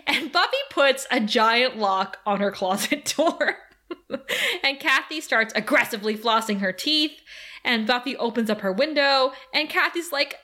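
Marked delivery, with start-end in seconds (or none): none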